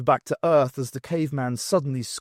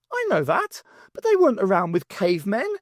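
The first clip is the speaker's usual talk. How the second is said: much higher impatient retort; higher irritated reply; exasperated; 7 semitones higher, 4 dB louder than usual. Acted. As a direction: snap back. Angie retorting while running.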